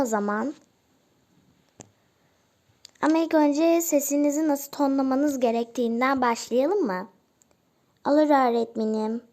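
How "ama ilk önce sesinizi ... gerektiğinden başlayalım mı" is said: normal talking voice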